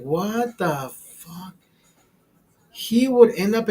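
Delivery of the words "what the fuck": very slow, drawn-out disbelief; stunned disbelief. Retold or imitated. Comic reacting to Fernando's confession, slow stunned disbelief. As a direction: let it hang in shock. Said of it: "I was like".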